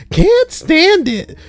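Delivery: slow, very high quavering cry; high drawn-out old lady wail. Shown as a demonstration. Trying another read of the patient, slow high wail. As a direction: stretch it out like a wailing granny. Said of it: "I don't know how I'm supposed to read that".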